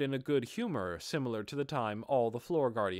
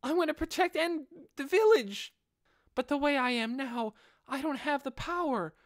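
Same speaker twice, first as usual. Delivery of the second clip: put-on high, boyish voice, earnestly pleading; high young voice begging a hero; 5 dB louder than usual. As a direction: play a determined boy begging to join the team. Narrator voices the boy Inferior.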